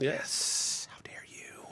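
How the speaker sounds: hushed mock triumph; whisper. Player quietly celebrating a correct guess, whispered. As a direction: whisper it to yourself, savoring it.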